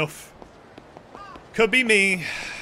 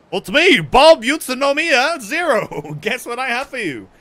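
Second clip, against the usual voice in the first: clown voice; 4 semitones higher, 6 dB louder than usual. Acted. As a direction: announce yourself like a goofy clown. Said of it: "That's a clown voice right there".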